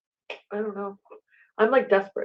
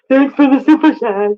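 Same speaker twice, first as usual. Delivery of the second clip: blurted out loud, higher; loud call-out; 3 semitones higher, 10 dB louder than usual. Real, not acted. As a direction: shout the thanks at the screen. Sudden loud reading of super chat.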